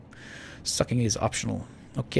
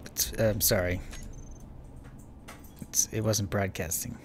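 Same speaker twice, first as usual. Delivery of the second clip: normal talking voice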